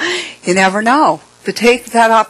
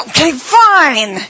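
higher, louder, slow reluctant reply; exasperated giving in; 9 semitones higher, 4 dB louder, slower than usual. Retold or imitated. Narrator gives in to the old woman's demand, mock exasperated. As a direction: sigh it out in mock defeat. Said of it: "So I'm like".

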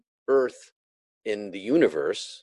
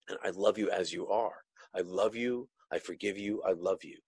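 soft loving reassurance; 7 dB quieter than usual. Retold or imitated. God's loving words retold.

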